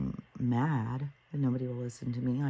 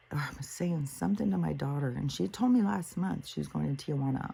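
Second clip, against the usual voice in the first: normal talking voice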